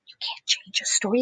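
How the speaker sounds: hushed conspiratorial whisper. Real, not acted. Storyteller whispering to young listeners about rules. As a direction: let them in on a secret, whispered. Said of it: "mock-serious aside to listeners".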